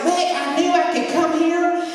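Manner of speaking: a lightly voiced desperate man asking for help; pleading stranger. Jeff pleading, voiced in story.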